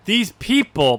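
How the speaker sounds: monotone voice. Retold or imitated. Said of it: "in the most monotone voice possible".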